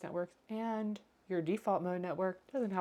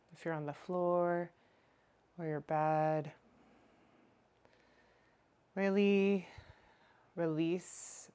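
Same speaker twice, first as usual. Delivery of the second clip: guided meditation. Acted. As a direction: draw out each phrase, leaving room to breathe. Leads a lying body scan.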